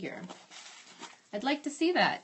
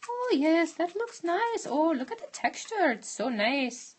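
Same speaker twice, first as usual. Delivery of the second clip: put-on Julia Child French accent; 9 semitones higher than usual. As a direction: gush over the texture in a hammed-up French accent. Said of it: "That's my Julia Child's, uh, French accent".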